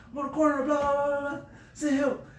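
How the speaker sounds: high, pleading voice; panicked 911 call. Retold or imitated. Calling 911 about his burning car, high and panicked. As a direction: beg for help in a rush. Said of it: "I called nine one one".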